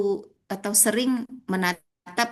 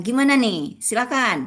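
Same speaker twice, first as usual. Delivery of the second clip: "Gimana nih" and "Silakan" lifted higher and louder; "Silakan" raised and punched as a demonstration of an upswing; rising emphasis, tanjakan; 5 semitones higher, 5 dB louder than usual. Shown as a demonstration. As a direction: push "Gimana nih? Silakan." up in pitch and volume. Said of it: "Nah, itu tanjakan".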